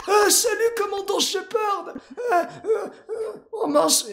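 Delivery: very high, dim-witted cartoon voice; dopey Barney. Bad actor greeting Commander Shepard, high dopey voice. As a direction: overact like a dopey bad comedian. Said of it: "un peu plus débile".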